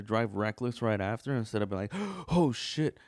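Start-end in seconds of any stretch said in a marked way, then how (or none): 1.9-3.0 sharp gasp, then loud high exclamation; startled, acted-out outburst; mock shock. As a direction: gasp like you just missed a crash. Acts out shocked near-miss reaction.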